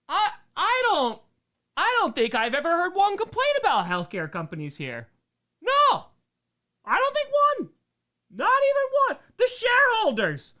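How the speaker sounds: high, loud, hammed-up indignation; sarcastic mock outrage. Streamer mocking healthcare companies to chat, high sarcastic outrage. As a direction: overplay the sarcasm about complaints.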